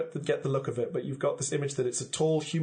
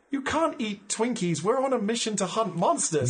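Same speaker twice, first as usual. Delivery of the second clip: incredulous scolding; 8 semitones higher than usual. Acted. [name] scolds junk-food eating.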